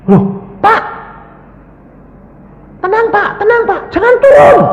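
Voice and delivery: high, loud, urgent pleading; acted flustered alarm; bewildered nurse. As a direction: shout to stop a patient getting up. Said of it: "Susternya kan kebingungan".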